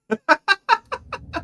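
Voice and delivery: loud high burst of laughter; big laugh. Real, not acted. Streamer laughing at a ban evader, loud high laugh.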